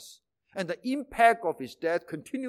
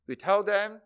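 usual speaking voice

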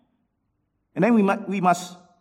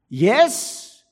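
YES louder and higher; emphatic yes; 5 semitones higher, 4 dB louder than usual. Retold or imitated. Preacher recapping the book's answer, emphatic YES. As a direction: punch out YES with a rising pitch. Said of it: "the answer is an emphatic yes".

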